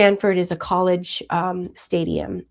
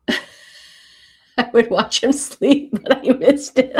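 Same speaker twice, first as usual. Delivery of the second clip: slow, giggling through the words; laughing while reading; 5 semitones higher, 6 dB louder, slower than usual. Real, not acted. Reading a fan's joke aloud, laughing. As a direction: laugh your way through the line. Reading a funny chat message.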